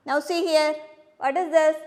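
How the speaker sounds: ordinary talk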